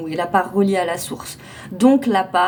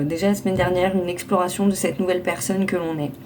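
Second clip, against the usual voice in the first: ordinary talk, nothing marked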